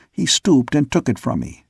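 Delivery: usual speaking voice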